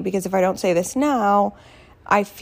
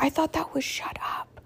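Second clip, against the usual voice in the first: hushed whisper, barely voiced; 8 semitones higher, 10 dB quieter than usual. Kid whispering a bad word to mom, hushed whisper. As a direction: breathe it like a secret swear word.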